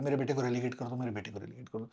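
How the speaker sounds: low, soft, insistent repeat of the request; repeated plea. Shown as a demonstration. Coaching the aunt to repeat her plea, softly. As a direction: keep murmuring the same plea softly. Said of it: "एक ही चीज बार-बार बोलते रहना".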